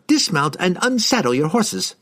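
high, eager wizard voice; a cheerful eccentric mage. A narrator's reading. Harkle voiced in narration.

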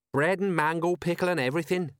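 pleased, lifted pitch; happy. A narrator's reading. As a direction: pipe up happily about the food. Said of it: "said Colon happily".

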